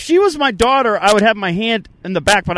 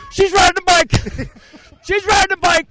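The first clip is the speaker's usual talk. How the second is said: high, carrying victory call; jubilant shout; 6 semitones higher than usual. Real, not acted. Coach announcing the rider's success, jubilant shout. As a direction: proclaim it for everyone to hear.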